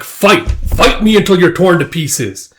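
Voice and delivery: fierce challenge. Acted. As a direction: act a monster demanding a fight. Monster challenges Guts.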